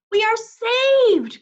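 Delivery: high, loud, slow relief; overjoyed rescue cry; cried out. A narrator's reading. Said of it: "cried Missus Watson".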